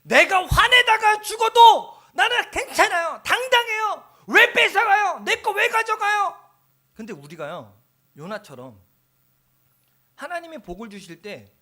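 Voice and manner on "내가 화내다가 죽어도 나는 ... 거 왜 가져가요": high, loud, indignant whining; defiant Jonah. Retold or imitated. Jonah answering God back, high, loud and indignant. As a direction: protest like a sulking child. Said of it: "요나가 뭐라 그래요?".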